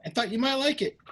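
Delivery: high-pitched, casual voice; friendly visitor. Acted. Visitor gives girl a toy, casually. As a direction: offer it casually, pitched well up.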